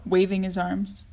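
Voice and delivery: ordinary delivery, nothing marked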